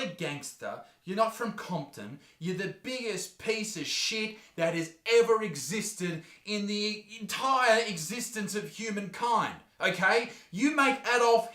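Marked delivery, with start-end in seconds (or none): none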